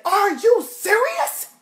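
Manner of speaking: very high loud slow shocked gasp; outraged are you serious. Retold or imitated. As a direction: drag out the shock like a scandalised friend. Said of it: "She looked at me and said".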